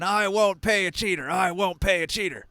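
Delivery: a half-giant repeating himself, raising his voice; giant shouting. Acted. Angry half-giant yells at the player over and over. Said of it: "shouts over and over again".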